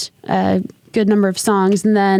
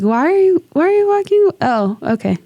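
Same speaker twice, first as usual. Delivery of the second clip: confused; 8 semitones higher than usual. Retold or imitated. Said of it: "I'm just like".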